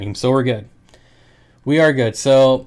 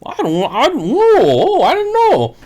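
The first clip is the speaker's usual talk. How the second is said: much higher and louder, hammed-up amazement; mocking customer; 5 dB louder than usual. Mocking every customer amazed at live fish, much higher and louder. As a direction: squeal it like a gushing customer. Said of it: "I always get the".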